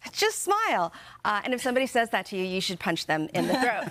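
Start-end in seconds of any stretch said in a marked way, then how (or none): 0.1-0.9 high, chirpy "just smile"; mocking "just smile". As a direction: toss it off like a pushy stranger. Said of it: "worst things that you can say".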